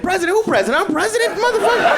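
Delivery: very high, loud, bewildered; confused old Biden. Acted. Comic Biden confused about being president. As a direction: squawk it like a lost old man. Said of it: "He like".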